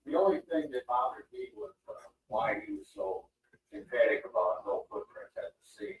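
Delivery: distant muffled tv dialogue; tv through the wall. Real, not acted. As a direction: background television bleeding in. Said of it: "Sorry about the T V noise in the background".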